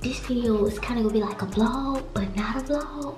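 low, hushed near-whisper. Real, not acted. Vlogger confiding plans while boyfriend is next door, whispering. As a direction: keep it secret, barely above a whisper. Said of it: "why I'm whispering".

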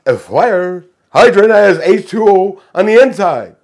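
loud vampire imitation; Dracula. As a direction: declaim it like a hammed-up vampire. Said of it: "Read the joke like Dracula".

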